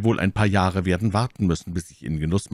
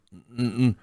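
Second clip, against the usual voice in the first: startled stammer; 3 semitones higher, much slower than usual. A narrator's reading. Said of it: "Sie erschrak ein wenig".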